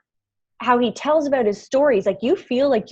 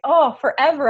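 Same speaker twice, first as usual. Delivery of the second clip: gushing delight; 6 semitones higher, 6 dB louder than usual. Retold or imitated. Star-struck reply when Les asks.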